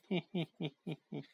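soft sneaky giggle; sly cackle. Acted. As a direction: cackle quietly to yourself. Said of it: "他咯咯地又笑了起来".

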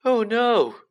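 high, loud cry of horror; dismayed gasp. A narrator's reading. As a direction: blurt it out in sudden dread. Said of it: "Ron gasped".

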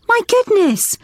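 gasp, then a high my goodness; delighted surprise. Acted. Teacher greeting children painted as tigers, mock amazed. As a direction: act amazed at the little tigers.

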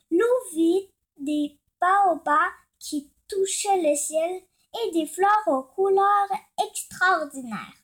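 plain reading voice

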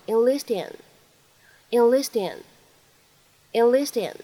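"enlist in" blended as one smooth word; repeated linked "enlist in"; linked reading. Shown as a demonstration. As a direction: run the t of "enlist" into "in". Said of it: "我们可以读成enlist in".

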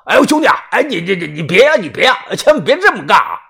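high, stammering, hurried begging; panicked pleading. Acted. Debtor hearing his glass door smashed, panicked pleading. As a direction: blurt it out to stop him.

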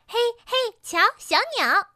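high, excited exclamation; excited calling out. A narrator's reading. Distracted pony spots a bird. (兴奋地尖声招呼)

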